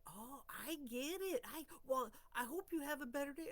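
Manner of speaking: boss voice. Retold or imitated. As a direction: voice the boss backing off kindly. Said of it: "boss voiced in story".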